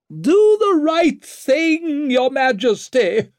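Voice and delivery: very high, loud, drawn-out shriek; sharp shriek. A narrator's reading. Lady Wetterland calling after the departing king, shrieking. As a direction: shriek it after someone hurrying away. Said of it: "shrieked after him, her voice so sharp".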